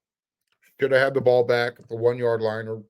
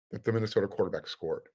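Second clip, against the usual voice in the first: ordinary talk, nothing marked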